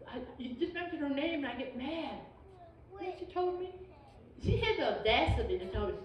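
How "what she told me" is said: usual speaking voice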